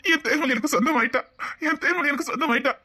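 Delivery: high, exultant declaration; giddy joy with a laugh in it; elated groom. Acted. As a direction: revel in having married her, voice lifted. Groom exults that she is his.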